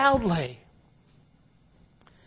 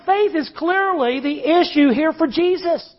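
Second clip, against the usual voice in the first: usual speaking voice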